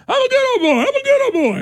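mock the folksy candidate, way up high and loud; cartoonish good-ol'-boy bragging; good old boy. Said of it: "Does that sound a little bit different".

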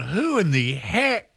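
high, slow and puzzled; astonished. Retold or imitated. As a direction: squint in disbelief at the sight. Watching a strange car arrive.

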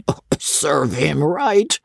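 slow, hushed scornful squire; scornful old baronet. Acted. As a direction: sneer it out like a mean old lord. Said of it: "said Sir Pitt".

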